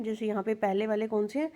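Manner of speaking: ordinary talk, nothing marked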